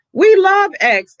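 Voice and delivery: high, loud and slow declaration; emphatic. Real, not acted. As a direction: proclaim it with feeling. Outburst of love for X.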